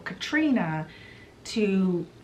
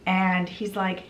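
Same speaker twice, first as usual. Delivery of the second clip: ordinary delivery, nothing marked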